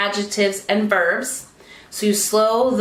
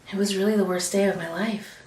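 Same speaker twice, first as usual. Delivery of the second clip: much softer, low and intimate; drawing listeners closer; 3 semitones lower, 6 dB quieter than usual. Shown as a demonstration. Speaker showing how a soft voice draws the audience closer. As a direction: pull the audience in with a hush. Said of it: "use your volume and even want to bring them closer".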